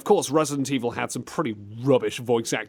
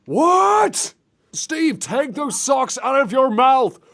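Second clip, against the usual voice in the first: very high, loud mock yell; comic exasperated bellow; exasperated shout; 11 semitones higher, 5 dB louder, slower than usual. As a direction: shout slowly at someone who can't be understood. Exasperated at muffled Steve.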